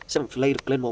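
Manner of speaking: ordinary delivery, nothing marked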